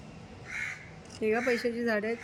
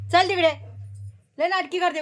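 loud, higher scolding of a fussy pet; 7 semitones higher, 3 dB louder than usual. Real, not acted. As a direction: shoo it away sharply. (रागाने मोठ्याने रागावणे)

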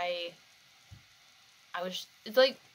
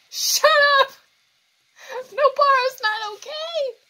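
much higher, loud, tearful; loud tearful outburst; distraught yell; 14 dB louder than usual. Real, not acted. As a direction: scream it through tears. Yelling at screen, upset.

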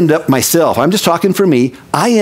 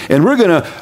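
ordinary talk, nothing marked

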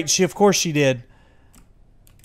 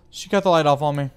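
panicked fast; 6 dB louder, much faster than usual. Real, not acted. Lights cut suddenly.

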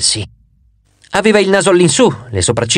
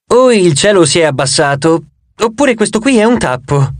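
ordinary delivery

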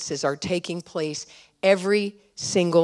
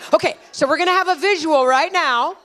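higher and louder, energized announcement; excited; 11 semitones higher, 5 dB louder than usual. Real, not acted. Pastor to the congregation, excitedly announcing a visual. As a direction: rev the room up for the visual.

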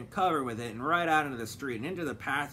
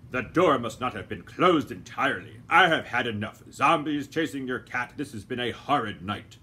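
lightly voiced gentleman; 6 dB louder than usual. A narrator's reading. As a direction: say it unhurried, brushing her off. Doctor Fogg complains.

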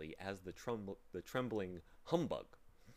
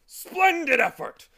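high, loud, slow hearty cheer; jovial humbug; 19 dB louder, slower than usual. Acted. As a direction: boom the praise like a pompous bug. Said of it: "commented the humbug jovially".

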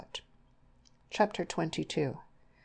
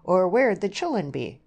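loud, higher-pitched retort; bursting out; 4 semitones higher, 7 dB louder than usual. A narrator's reading. Mother breaking in on the suitor's declaration, bursting out. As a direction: burst in with a blunt objection. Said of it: "Missus Arden burst out".

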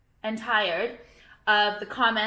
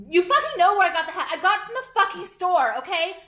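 higher and louder, exasperated; loud fed-up rant; 6 semitones higher, 5 dB louder than usual. Real, not acted. Answering fans about the hat, loud exasperated snap.

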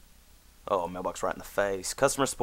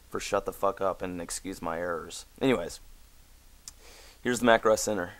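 ordinary delivery

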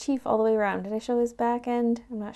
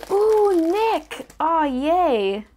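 loud, high delighted squeal; excited; 7 semitones higher, 8 dB louder, much slower than usual. Real, not acted. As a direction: cheer at the reveal. Reveals figure.

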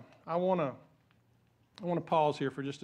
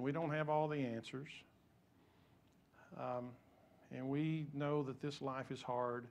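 soft, sincere prayer; 3 semitones lower, 6 dB quieter than usual. Real, not acted. Pastor praying for Diane's family, soft and sincere. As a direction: pray gently for the grieving family.

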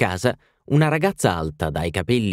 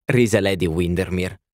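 ordinary delivery

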